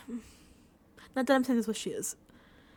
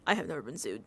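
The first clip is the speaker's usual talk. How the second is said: smug doctor; 11 semitones lower, 6 dB quieter than usual. Retold or imitated. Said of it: "Doctor Oshimi's like".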